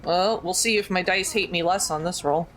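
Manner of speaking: ordinary talk, nothing marked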